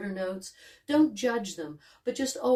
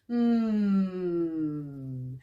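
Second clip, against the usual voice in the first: sustained hum with a gentle pitch glide; long steady hum; 2 semitones lower, 3 dB quieter than usual. Shown as a demonstration. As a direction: hum out on one breath. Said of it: "natural pitch glide".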